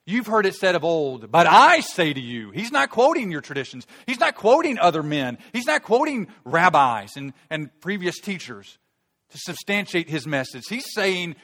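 loud, high, slow emphatic preaching; preaching at full force. Acted. Preacher driving home Jesus' authority to the congregation, loud and fervent. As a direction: thunder it out from the pulpit.

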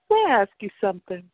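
jazz voice. A narrator's reading. Narrator begins questioning the man, in a quick jazz voice. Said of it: "as I switched to my jazz voice".

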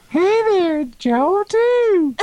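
very high, goofy falsetto character; Joel Two. Acted. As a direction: squeak out a silly cartoon greeting. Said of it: "bust into the goofy voices".

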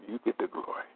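hushed whispered praise. Acted. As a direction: whisper it reverently. Whispered during healing prayer.

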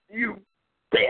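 ordinary delivery

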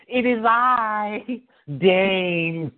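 slow, stately "It is I, Dane"; a mock-regal entrance, stretched slow; grand slow Dane impression. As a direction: announce yourself like royalty. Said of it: "repeats Dane bit".

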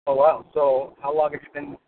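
usual speaking voice

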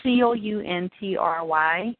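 slow letter by letter spelling. Shown as a demonstration. Spelling the school name for the interviewer, slow. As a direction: spell each letter out slowly. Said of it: "Spell it".

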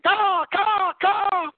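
high screeching crow caw. Retold or imitated. Crow flies off cawing.

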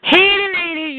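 high, loud teasing call. Acted. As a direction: holler at her playfully. Calling out to caller.

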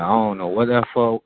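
normal talking voice

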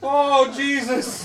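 slow, high laughing exclaim. Real, not acted. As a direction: draw it out cracking up. Laughing at mishap.

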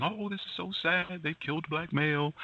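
high, sarcastic mock lament; mock crying. Mocking the women in the photo, high fake sorrow. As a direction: whine it like fake grief. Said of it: "They're crying".

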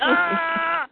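high, drawn-out acted yell; mock fighting yell, held long; guard's battle cry. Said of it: "the other guard's going".

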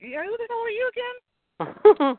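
high, slow, incredulous teasing; mock disbelief. Son teases his mom with high, slow mock disbelief. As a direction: tease her like a stranger. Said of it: "And I'm like".

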